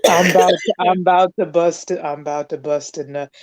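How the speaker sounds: low, dropping mock moan of "I'm about to bust a nut"; mock climax.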